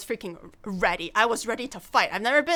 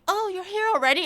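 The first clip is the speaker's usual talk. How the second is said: mild mock surprise; 8 semitones higher, faster than usual. Friends surprised she came.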